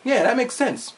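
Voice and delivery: high-pitched eager yes-man; mock-compliant high voice; retold henchman. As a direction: agree instantly, like an obedient minion. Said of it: "the guy's like".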